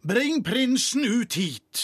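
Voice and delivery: king ordering the guard, authoritative; commanding voice. A narrator's reading. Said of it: "sa med myndig stemme".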